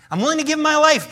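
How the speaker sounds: shouted high boast on life; Peter's vow mocked loudly. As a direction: echo his bold vow sarcastically. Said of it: "when Jesus said".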